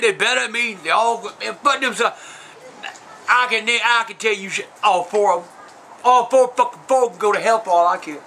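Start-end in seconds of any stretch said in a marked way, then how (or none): none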